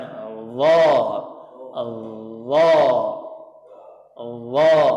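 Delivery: "Allah" with a thick, full l; heavy Allah. Shown as a demonstration. Teacher modelling the heavy Allah for the student. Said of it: "nah, gitu seperti itu".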